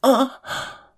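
high sudden moan of pain; short high cry of pain and pleasure; sharp moan. Acted. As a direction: gasp out a moan as the cane lands. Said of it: "pushing a moan from my lips".

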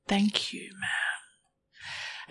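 breathy whispered thank you; hushed whispered gratitude. Acted. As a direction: whisper it, barely voiced. Partner made to thank after slap.

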